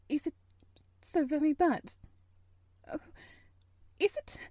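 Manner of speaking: frightened pleading. Acted. Frightened mother begs officer.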